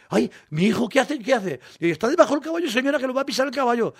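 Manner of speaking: madre imitada, voz mucho más aguda; madre asustada. Retold or imitated. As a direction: alarma de madre al ver a su hijo. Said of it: "Y mi madre dice". (la madre avisa a la señora de que el caballo va a pisar al niño, voz aguda y asustada)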